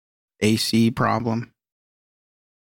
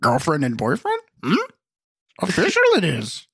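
high wise little alien voice; Yoda voice; 8 semitones higher than usual. Pretend. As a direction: talk backwards like Yoda, squeaky. Yoda-style talk about dating.

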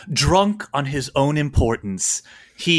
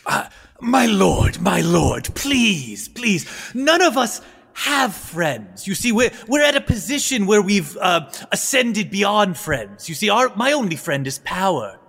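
put-on higher-pitched, halting old courtier; old adviser, pleading; 6 semitones higher than usual. Old man approaches his lord, pleading nervously. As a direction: beg his lordship with fussy deference.

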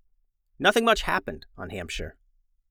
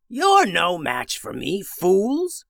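villain yelling; 5 semitones higher, much slower than usual. Acted. Wizard gloats over the frozen villagers, taunting yell. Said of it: "he yelled".

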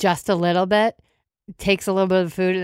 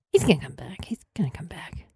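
hushed whisper to herself; quiet whispered reassurance to herself; anxious whisper; 6 dB quieter than usual. Acted. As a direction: whisper it like a hopeful prayer. Bride alone hoping.

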